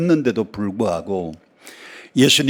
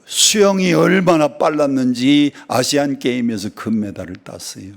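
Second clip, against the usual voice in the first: ordinary talk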